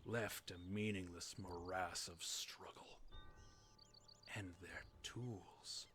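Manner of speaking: slow whispered lament; whispered sorrow over lost tools; mournful whisper. A narrator's reading. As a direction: let it fade to a slow, grieving whisper. Lore reading drops to whisper.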